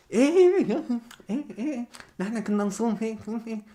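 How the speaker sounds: high mocking yes yes; mocking imitation. Vlogger mimicking the guests nodding along, high mocking voice. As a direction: chirp the yeses mockingly.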